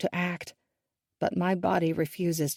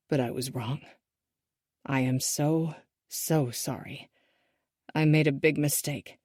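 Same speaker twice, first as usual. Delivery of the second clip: regretful; 3 semitones lower, 4 dB quieter than usual. A narrator's reading. Father apologizing to his daughter, soft and regretful. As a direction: apologize softly, full of regret. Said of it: "laden with regret".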